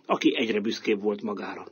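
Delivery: ordinary delivery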